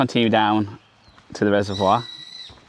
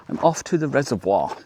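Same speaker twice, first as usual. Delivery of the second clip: put-on posh accent; 4 semitones higher than usual. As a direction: say "reservoir" like landed English gentry, pitch raised. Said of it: "You can do it in a very posh accent".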